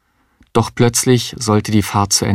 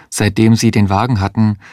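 usual speaking voice

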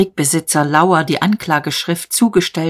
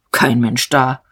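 growled; 4 semitones lower than usual. A narrator's reading. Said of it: "Der Major knurrte".

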